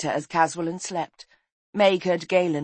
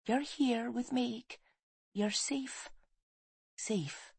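slow, very soft reassurance, pitched up; soothing whisper; 7 semitones higher, 9 dB quieter, much slower than usual. A narrator's reading. Woman strokes dreaming boy's hair, whispering comfort. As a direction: murmur comfort, fading softer to the end. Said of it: "she whispered".

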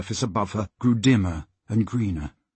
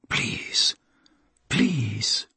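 faint, breathless whispered plea; begging in a whisper, almost gone; 3 semitones lower, 5 dB quieter than usual. A narrator's reading. Drowning girl pleads, fading.

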